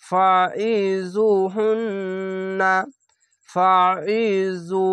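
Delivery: slow, drawn-out chanted recitation; Quranic recitation. Shown as a demonstration. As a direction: recite each word slowly with tajweed. Recited demo.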